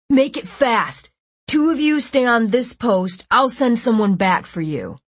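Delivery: bossy gang leader orders; commanding. A narrator's reading. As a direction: bark the orders.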